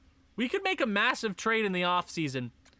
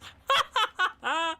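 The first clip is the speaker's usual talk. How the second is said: high laugh; 11 semitones higher than usual. Real, not acted. Laughing at bus driver joke.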